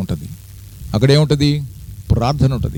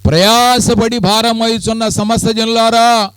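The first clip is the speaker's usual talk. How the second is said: high, slow calling out to the weary; voice of the Lord calling; 8 semitones higher, 3 dB louder, slower than usual. Acted. Preacher voicing the Lord inviting the weary people. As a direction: call them gently but loud. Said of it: "ప్రభు మాట్లాడుతున్నాడు".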